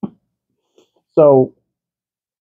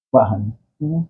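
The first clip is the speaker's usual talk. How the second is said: ordinary talk, nothing marked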